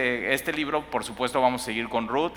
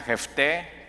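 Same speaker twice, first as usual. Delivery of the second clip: "Jefté" lento, con acento en la É final; 4 semitones higher than usual. Shown as a demonstration. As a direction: di "Jefté" despacio, cargando la última E. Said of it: "con acento en la E al final". ("Jefté" con la sílaba final acentuada)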